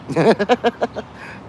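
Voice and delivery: high-pitched chuckle; real, high run of laughter. Real, not acted. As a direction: laugh at your own remark. Laughs after offering nannies.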